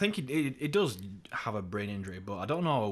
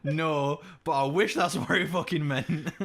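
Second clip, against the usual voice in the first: louder, higher, breaking into laughter; amused outburst; 6 semitones higher, 5 dB louder than usual. Acted. Replying to Americans' absurd guess, amused outburst. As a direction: exclaim it through a laugh.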